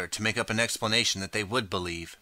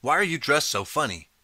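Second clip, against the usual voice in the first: higher, nosy crowd question; suspicious, mocking demand; 6 semitones higher, 3 dB louder than usual. A narrator's reading. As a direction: press the stranger for an answer. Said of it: "people in the crowd demand".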